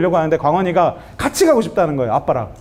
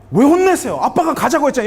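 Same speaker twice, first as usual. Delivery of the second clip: imagined protest; 6 semitones higher than usual. Acted. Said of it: "아빠가 혼냈으면".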